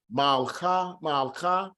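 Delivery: malcha as mal-cha, no vowel between l and ch; teacher drilling malcha. Shown as a demonstration. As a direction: repeat malcha in two syllables. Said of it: "we do not vocalize the shiwa itself".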